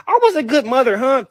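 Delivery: lightly voiced mother mocking; a touch of his mom's self-pity. Son imitating his mother's complaint, lightly mocking. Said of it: "call one of my aunties like".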